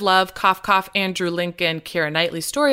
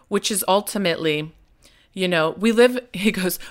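ordinary talk, nothing marked

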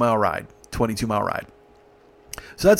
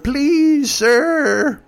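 put-on high, pleading old-grandma voice; pleading old lady; 12 semitones higher, 4 dB louder than usual. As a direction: beg like a tiny old grandmother. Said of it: "the grandmother's like".

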